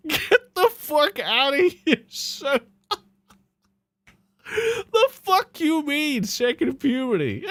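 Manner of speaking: high-pitched, cracking up while ranting; laughing disbelief. Real, not acted. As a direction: laugh through the outrage at the absurd claim. Mocking streamer's second puberty claim.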